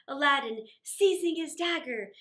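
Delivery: ordinary delivery, nothing marked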